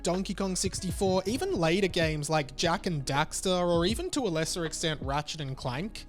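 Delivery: normal talking voice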